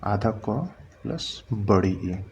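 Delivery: "आधा क प्लस बड़ी ई" said slowly, word by word; slow breakdown. Shown as a demonstration. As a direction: lay it out slowly for students. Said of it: "ध्यान दीजिए". (धीरे-धीरे, हर शब्द अलग)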